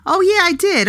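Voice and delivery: high-pitched, amused realisation; real delighted "oh yeah" of remembering; sudden recognition. Real, not acted. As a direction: let the memory hit you and jump up in pitch. Suddenly recalls the story mid-answer.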